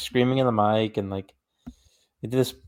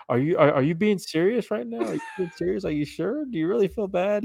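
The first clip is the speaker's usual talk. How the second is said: incredulous; 8 semitones higher than usual. Retold or imitated. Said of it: "he's just trying to be like".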